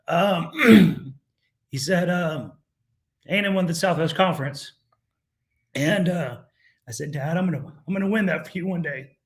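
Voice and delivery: choked up, pitch climbing as he fights tears; voice breaking with tears. Real, not acted. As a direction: near crying telling his dad's story. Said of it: "Damn, the waterworks".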